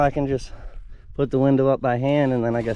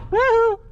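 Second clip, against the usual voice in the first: excited whoop. Real, not acted. Engine starts, cheering.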